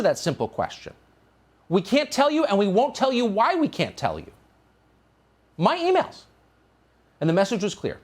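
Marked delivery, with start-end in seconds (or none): 1.7-4.3 high, smug mock refusal; mock stonewalling. Host mimicking NSA officials refusing, high and smug. 5.6-6.2 high, incredulous stress on emails; can't believe it, pitched high; indignant disbelief. Real, not acted. Host outraged about his read emails, high incredulous.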